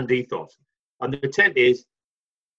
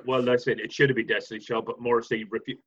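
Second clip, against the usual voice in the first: quiet mock announcement; 6 semitones lower, 5 dB quieter, faster than usual. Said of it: "just go".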